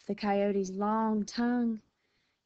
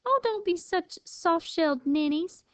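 high-pitched sisterly scoff; 8 semitones higher than usual. A narrator's reading. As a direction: tease them for being scared, pitched up. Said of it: "Lily scoffed".

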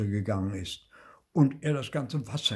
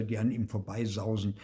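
usual speaking voice

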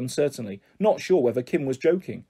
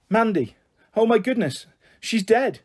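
higher-pitched shocked exclamations; horrified alarm; 7 semitones higher than usual. Acted. Onlooker finds girl lying in the road, horrified. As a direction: cry out in horror.

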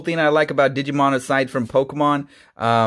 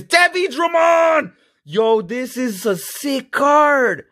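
higher, slow excited call-out; real hype over a great pull; hyped shout at a cool card; 9 semitones higher, slower than usual. Real, not acted. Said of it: "I'm getting too excited right now".

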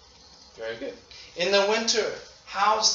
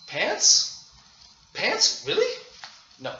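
mock surprise; 9 semitones higher than usual. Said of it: "what are you going to say?".

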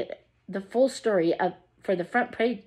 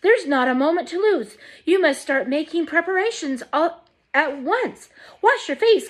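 excited orders; 8 semitones higher than usual. A narrator's reading. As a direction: rattle off the chores in glee. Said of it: "shouted Grandpa Joe".

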